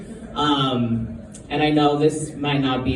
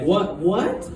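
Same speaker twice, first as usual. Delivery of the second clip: retold disbelief; 5 semitones higher than usual. Acting out the wife's disbelief.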